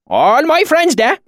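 put-on high, drawn-out rustic swineherd accent; slow, hearty backwoods dialect; thick valley accent. A narrator's reading. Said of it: "his accent so thick and oily".

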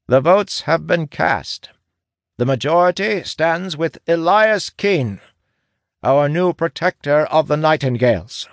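high-pitched official announcement; proclaiming scribe. A narrator's reading. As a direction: call the vote to the whole assembly.

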